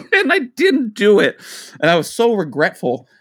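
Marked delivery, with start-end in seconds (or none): 0.1-1.4 laughing. Real, not acted. Laughing through the retelling.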